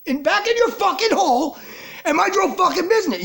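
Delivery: high, loud furious yell at the clerk; telling the pestering clerk off, high and loud; imagined angry outburst. Retold or imitated. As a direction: blow up and scream it at her. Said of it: "I didn't say that, but you know what I mean?".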